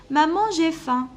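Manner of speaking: put-on child calling mom. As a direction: call out to mom like a hungry kid. Child calls mother, hungry. (appel d'enfant affamé, plus aigu et plus fort)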